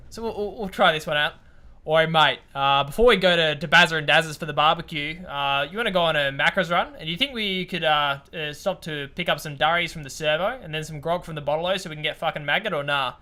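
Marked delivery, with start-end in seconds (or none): 1.8-13.1 loud, broad Australian slang; a hint of a rowdy Aussie bloke; Australian accent. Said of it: "the most Australian sentence you can".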